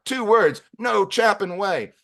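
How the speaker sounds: high, emphatic mock refusal; losing his chips. Claudio refuses the receptionist's supposed insult, losing his chips. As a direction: punch it out high, dragging the last words. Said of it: "Claudio's losing his chips".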